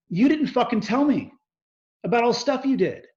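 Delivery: high, loud mock yell of an angry investor; retold yell. Investor yelling at him on the phone, loud and high. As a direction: throw it back the way he shouted it. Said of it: "he yells at me".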